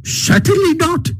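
very high, defiant refusal; emphatic. A narrator's reading. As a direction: refuse outright in Toad's high voice. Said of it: "replied Toad emphatically".

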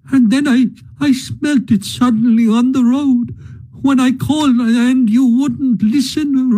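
high, tearful pleading of a grieving mole. Acted. Mole pleading with Ratty about his lost home, tearful and high. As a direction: let the grief spill out in a high, wavering voice. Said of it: "sobs again took full charge of him".

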